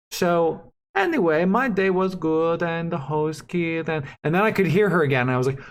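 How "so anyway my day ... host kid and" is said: slow cut-out voice; fading phone voice. Retold or imitated. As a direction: sound like a bad connection. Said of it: "It sounds like a robot".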